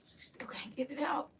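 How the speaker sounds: barely able to say it; a shaken whisper; hushed. Real, not acted. Hushed retelling of shock.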